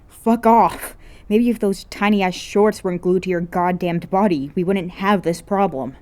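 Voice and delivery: put-on loud, boyish snap; boy swearing at his teasing friend; angry yell. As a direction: shove her off with words. Akito snaps at An.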